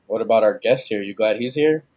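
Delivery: ordinary delivery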